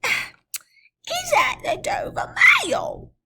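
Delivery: very high, slow hushed call; whispered shout. Acted. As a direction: call out hoarsely through the fog. Stranger calling in the mist.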